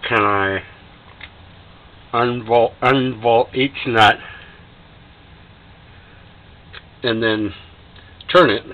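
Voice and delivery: talking around a flashlight held in teeth; words muffled by something in the mouth. Real, not acted. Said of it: "it's gonna sound funny 'cause I got a s-, flashlight in my mouth here".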